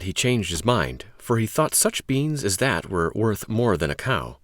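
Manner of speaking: ordinary delivery, nothing marked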